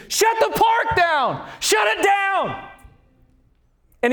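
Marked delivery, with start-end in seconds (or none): 0.1-2.7 frantic high-pitched shout, words stretched out; scream. Retold or imitated. Father screams at the park entrance for his lost girl, frantic high shout. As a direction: imitate a panicked father screaming, high and drawn out. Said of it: "He's screaming".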